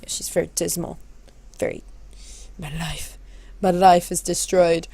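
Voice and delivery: mope quietly, as if life is over; dismal Alice. Acted. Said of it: "I have to act like Alice".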